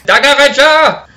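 high, loud cartoon villain cry; villain yell. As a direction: bellow the name like a villain. Mock villain yelling name.

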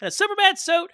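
high excited mock fan; hammed-up eager fan voice; mock whiny wish. Mocking own past wish.

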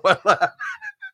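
high-pitched burst of laughter; laughing at grandma's flustered denial; high giggle. Real, not acted. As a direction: giggle at the joke. Laugh after her denial.

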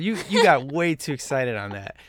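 ordinary talk

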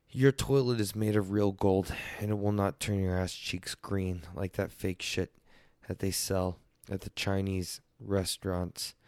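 ordinary talk, nothing marked

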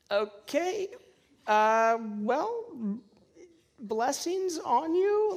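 high, halting, questioning lilt; stall, then offer it slowly and uncertainly; mock-embarrassed retreat with rising pitch; awkward backpedal. Awkward recovery after a wrong guess.

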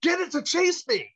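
a manic Walken plea; imitating Walken. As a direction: egg him on like a giddy Walken. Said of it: "he goes".

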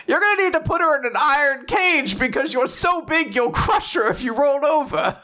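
put-on high, fretting old-lady voice; elderly helper correcting the new dad; fussy elderly helper. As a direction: fuss over the bottle like a worried granny. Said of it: "They're like".

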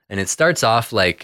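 ordinary talk

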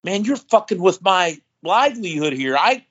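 mad coworker. Retold or imitated. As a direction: complain like the annoyed coworker. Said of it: "He goes".

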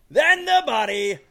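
high shouted build with a very long held note; ring announcer introduction. Pretend. Host introducing his buddy from Kirkcaldy, drawn-out announcer shout. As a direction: stretch the hometown out like a ring announcer.

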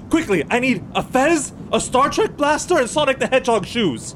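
high, urgent mad doctor cries; frantic mad scientist. Acted. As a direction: rush Igor in a panic. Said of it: "Igor, quickly".

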